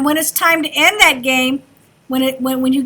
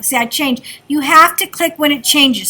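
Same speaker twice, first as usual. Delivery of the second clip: usual speaking voice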